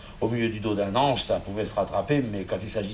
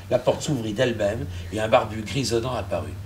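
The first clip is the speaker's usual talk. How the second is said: normal talking voice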